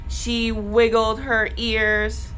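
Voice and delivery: ordinary delivery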